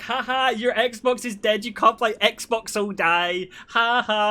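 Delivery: high, drawn-out jeering imitation; mocking sisters. Sisters terrorizing brother over his dead Xbox, high mocking jeer. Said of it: "terrorized by my sisters for no reason".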